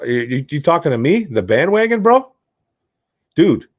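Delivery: high, rising to a loud yelp on bro; mock offended. Real, not acted. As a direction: act stunned by the question. Mock offended at bandwagon question.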